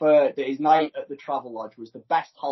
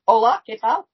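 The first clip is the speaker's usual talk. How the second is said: Spanish greeting; 10 semitones higher, 9 dB louder than usual. Pretend. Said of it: "can you speak Spanish".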